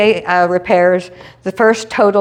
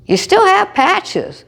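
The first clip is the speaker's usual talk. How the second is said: high slow nudge; 12 semitones higher, much slower than usual. Retold or imitated. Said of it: "And I thought".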